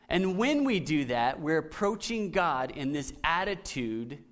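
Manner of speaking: normal talking voice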